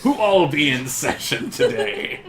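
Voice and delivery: hammed-up formal question; mock grand address. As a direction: call it out drawn out and theatrical. Player mock-grandly addresses council host.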